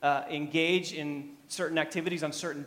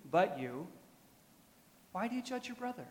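softer, higher questioning read; 4 semitones higher, 5 dB quieter than usual. Acted. Preacher first reading Paul's question to the church, soft challenge. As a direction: read the challenge softly, pitch lifting on the question.

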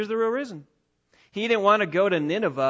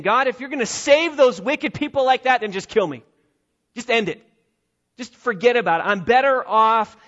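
loud, higher whining complaint; angry plea; 4 semitones higher, 7 dB louder than usual. Acted. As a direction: rage at God like a sulking prophet. Jonah voiced asking to die.

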